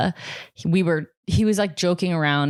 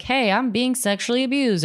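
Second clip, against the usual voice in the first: high-pitched imitation of a younger self; younger self pleading; 7 semitones higher than usual. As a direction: pitch it up as if telling unheeding adults. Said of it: "I was trying to tell people".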